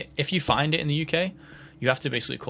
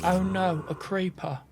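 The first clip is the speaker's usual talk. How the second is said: mock British accent, higher, "creeper" as "craypah"; put-on British child spotting danger; British kid; 6 semitones higher than usual. Gamer quoting a funny video, mock British kid accent. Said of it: "this British kid goes".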